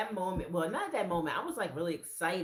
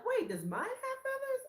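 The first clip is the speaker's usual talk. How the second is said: much higher, eager question; sudden hopeful gasp; surprised squeak; 4 dB louder than usual. Retold or imitated. Checking her own shawl, high and eager.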